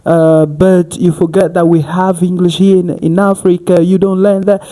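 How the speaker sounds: high, loud scolding commenter; critical online commenter. Retold or imitated. Quoting online critics who scold African learners, imitating. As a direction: lecture as a preachy critic. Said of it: "many people online saying like".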